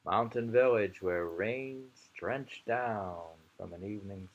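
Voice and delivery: slow, drawn-out poem reading with long weight on each word; very slow poetry reading. Shown as a demonstration. Reading a Japanese poem aloud to the camera, deliberately slow. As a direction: read it as slowly as possible, letting each line hang. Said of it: "We're reading it slow as possible".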